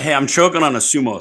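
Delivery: talking with a full mouth. Real, not acted. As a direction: speak through a mouthful. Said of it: "jam more of it in my mouth".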